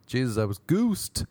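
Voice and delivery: ordinary talk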